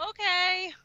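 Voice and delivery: high, wide-eyed little okay; mock innocent okay. Kid answering mom's warning, high mock-innocent okay. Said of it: "I'm like".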